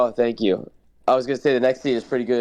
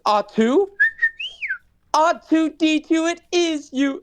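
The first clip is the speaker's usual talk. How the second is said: very high, slow, tearful robot reunion cry; overjoyed droid; slower than usual. Retold or imitated. Droid finding his lost partner aboard, tearful high cry. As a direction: sob with relief at finding your friend. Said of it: "He's like".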